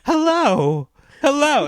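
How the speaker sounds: loud, high, insistent phone hellos; imitating unaware older lady. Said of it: "over the phone and she'd go".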